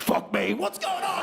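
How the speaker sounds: high mock shout of a line; lightly voiced mum, pitched high. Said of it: "Now she's like".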